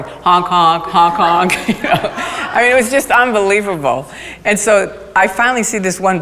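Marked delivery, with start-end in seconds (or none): 0.0-1.7 passersby honking with their mouths, laughing; mock honking. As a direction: chant honk honk like a car horn. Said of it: "People are walking by going".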